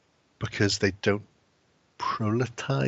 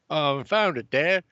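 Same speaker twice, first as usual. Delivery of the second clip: higher, lightly voiced eight-year-old boy; excited little boy; 6 semitones higher, 3 dB louder than usual. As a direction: pitch it up like a proud little kid. Young son answers his dad.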